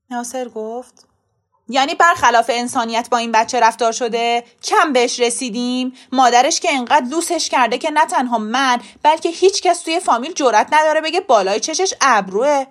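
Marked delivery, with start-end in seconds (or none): 1.7-12.6 loud, rising indignation; affronted father arguing loudly; indignant self-defence. A narrator's reading. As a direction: push back forcefully at the accusation. Father rejects accusation of mistreatment.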